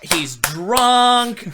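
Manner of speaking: high, loud, frantic retelling of her call; shrieking the ex's accusations, hammed-up; mock 911 accusation. Mocking the ex's call to 911, high and loud.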